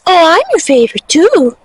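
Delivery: cutesy imagined animal boast; voicing the sheep. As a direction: play the favorite sheep with swooping pitch. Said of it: "So she's like".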